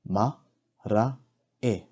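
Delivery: "Ma, ra, e" with a clear gap after each syllable; broken into syllables. Shown as a demonstration. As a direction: sound out each syllable separately. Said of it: "break down the words first".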